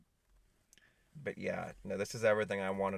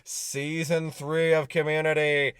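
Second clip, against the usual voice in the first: slow booming TV announcer; 6 semitones higher, 10 dB louder, slower than usual. Pretend. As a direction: boom it like a show intro. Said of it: "I turned into S N L".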